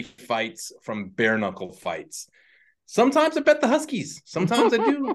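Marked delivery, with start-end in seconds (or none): 2.9-5.1 high goofy put-on voice; squeaky silly character voice.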